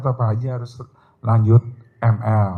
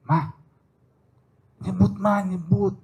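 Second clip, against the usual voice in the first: husband's urging; 9 semitones higher than usual. Retold or imitated. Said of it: "dia bilang".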